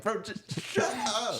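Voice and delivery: high, slow, mock childish whine; squeaky, drawn-out playground protest; kindergartner tantrum. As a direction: imitate a little kid fed up with being talked over. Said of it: "like, kindergarten".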